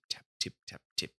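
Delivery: soft, breathy tip-tap like tiny feet; whispered tapping. A narrator's reading. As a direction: whisper the tapping like little mice. Tapping sounds in the story.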